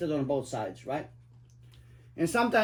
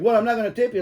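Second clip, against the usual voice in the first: higher, louder snooty refusal; snooty customer; 6 semitones higher, 7 dB louder, faster than usual. Retold or imitated. Stingy passenger refusing the cab driver's tip, snooty and loud. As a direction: sniff it out like a stingy snob. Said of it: "Some people's like".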